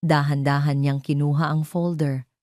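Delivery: ordinary delivery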